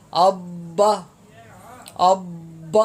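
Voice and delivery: اب with the ب bounced out at the end, echoed on the stop; qalqala demo. Shown as a demonstration. Teacher demonstrating the stop, bouncing ب in اب. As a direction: pop the ب of اب when stopping. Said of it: "اب دوسرا خود ہی نکلے گا".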